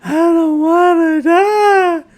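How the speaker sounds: high, slow, whiny fake sob; mock crying. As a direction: wail it like a little girl crying, hammed-up. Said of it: "crying like a little girl".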